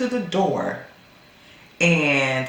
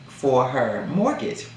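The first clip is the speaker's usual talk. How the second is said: normal talking voice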